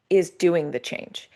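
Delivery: ordinary talk, nothing marked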